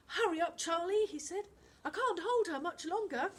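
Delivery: put-on a boy struggling to hold on; straining boy voice. Finn to Charlie in the water, high and urgent.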